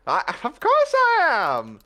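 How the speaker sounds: high, loud and slow, delighted with himself; flattered laugh. Acted. Puss flattered by Zenith, laughing high and smug. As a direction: gloat through a laugh.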